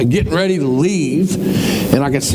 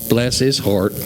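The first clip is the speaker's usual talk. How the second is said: Southern bless; 6 semitones lower, slower than usual. Retold or imitated. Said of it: "people say in the South".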